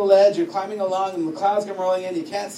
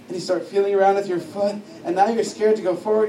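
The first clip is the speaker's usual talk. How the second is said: ordinary delivery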